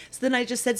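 ordinary delivery

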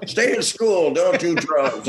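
high, earnest PSA announcer; public announcement voice. Retold or imitated. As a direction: sell it like a cheesy public service spot. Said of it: "public announcement".

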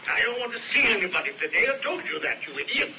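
curt, impatient brush-off, fast; irritable snapping. Acted. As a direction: snap at the secretary for interrupting. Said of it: "He always like that?".

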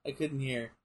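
ordinary delivery

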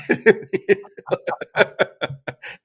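high, loud burst of laughter; hearty laugh. Real, not acted. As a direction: laugh out loud at your own story. Laughs at his own retold bafflement.

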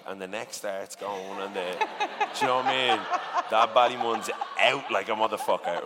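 ordinary talk

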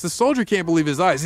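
ordinary talk

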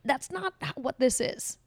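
high indignant outburst; angry. Retold or imitated. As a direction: protest it, outraged and loud. Acting out angry people.

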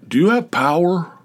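high, loud call from the dark; a startled voice out of nowhere; neighbor calling out. Retold or imitated. Neighbor calling out of the dark, high and loud.

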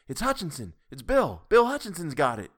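high, loud exclamation; excited. A narrator's reading. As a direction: call it out in excitement. Crowd calls out the name.

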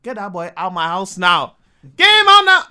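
furious mother yelling; angry mum. Retold or imitated. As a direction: throw the boy out of the house. Angry mum voiced in story.

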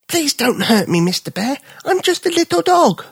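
a high, pleading little dog. Acted. Little dog begging the bear, high pleading voice. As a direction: beg the bear not to hurt you, high and small.

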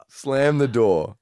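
ordinary talk

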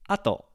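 ato, A high and TO stepping down; falling ato. Shown as a demonstration. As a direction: say "ato" starting high and simply going down. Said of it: "it simply goes down".

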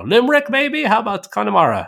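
put-on bluffing in a mild Irish accent. Dodging a question about Cork, light Irish accent. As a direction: guess at towns in a light Irish brogue. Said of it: "I could do the Irish thing".

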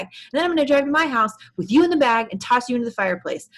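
loud, high, fast rant about presents; furious rant. Retold or imitated. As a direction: rattle off the threats in a mounting fury. Gus's angry rant, voiced.

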